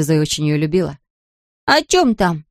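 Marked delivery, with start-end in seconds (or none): 1.6-2.5 put-on high, eager child's question; excited high curiosity. A narrator's reading. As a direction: ask eagerly like a small boy. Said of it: "взволнованно спросил Патрик".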